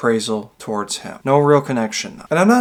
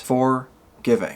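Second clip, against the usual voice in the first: FORGIVING said slowly as two words, FOR, pause, GIVING; "Forgiving" slowly parted into for ... giving; split into for, giving; slower than usual. Shown as a demonstration. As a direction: break "Forgiving" after FOR, drawing it out. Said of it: "within the wording of it".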